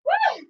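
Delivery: short, high, loud woo; excited whoop. Real, not acted. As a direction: cheer the class along. Cheering on the workout.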